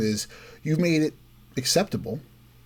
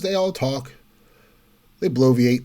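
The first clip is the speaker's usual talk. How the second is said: normal talking voice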